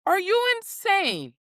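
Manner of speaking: rising outraged are-you-insane rant; incredulous scolding. Retold or imitated. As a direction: scold them like they've lost their mind. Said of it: "and it's like".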